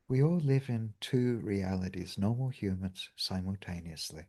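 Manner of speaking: soft, low meditation voice; hushed guiding voice for a meditation; guided meditation. Acted. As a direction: draw them gently inward. Leads a meditation.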